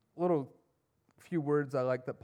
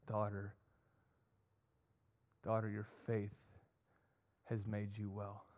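softer, lower, gentle address; slowed down, tender; 4 semitones lower, 9 dB quieter than usual. Acted. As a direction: lower your voice and speak gently to her. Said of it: "he slows down and says".

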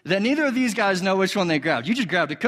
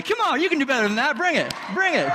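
high-pitched rallying call; hyping up the crowd; 8 semitones higher than usual. Real, not acted. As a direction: work the crowd for more noise.

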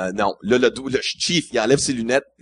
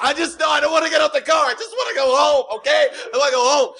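much higher, laughing mock sob; crying like a little girl; 10 semitones higher than usual. Driver pleads with the border guard, mock crying. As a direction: whimper it like a scared kid begging. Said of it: "je commence à pleurer comme une petite fille".

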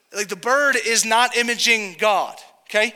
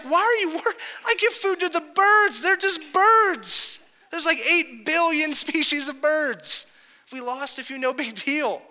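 incredulous exasperation; 8 semitones higher than usual. Retold or imitated. Preacher voices Jesus to worriers about birds, high incredulous exasperation. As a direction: imitate someone baffled at needless worry, pitched way up.

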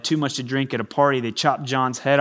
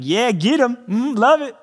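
much higher, cheering the preacher on; amen-corner enthusiasm, higher and louder; cheering along; 10 semitones higher, 5 dB louder than usual. Retold or imitated. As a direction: egg on the preacher from the pews. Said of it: "I'm like".

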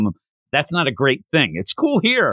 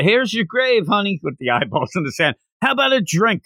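lightly voiced, higher sad drunk; a drunk man raising a glass at the grave, pitched up; tipsy mourner; 6 semitones higher than usual. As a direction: toast the dead like a maudlin drunk.

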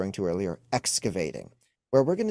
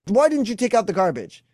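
higher, louder nagging question; mock angry wife; 10 semitones higher, 7 dB louder than usual. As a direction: snap the garbage question at him. Acted wife's question.

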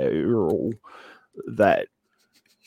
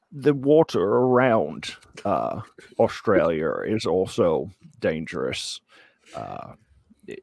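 usual speaking voice

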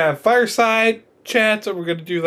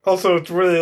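ordinary talk

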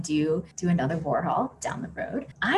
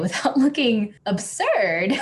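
laughing; 8 semitones higher, 4 dB louder than usual. Real, not acted. Laughing at own failed smile.

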